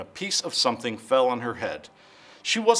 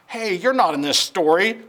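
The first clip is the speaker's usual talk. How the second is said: higher, louder narrator voice by mistake; wrong voice, narrator's; 6 semitones higher, 5 dB louder than usual. Acted. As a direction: slip into the wrong character voice. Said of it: "I'm getting my voices mixed up".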